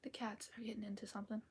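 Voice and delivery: whispering. Real, not acted. Whispered chat on stream.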